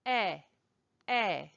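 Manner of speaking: short "Eh" with a breathy h-like end. Shown as a demonstration. Teacher correcting the Brooklyn version, short breathy "Eh". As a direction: say "Eh" short, no glide. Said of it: "it's a breathy E H almost".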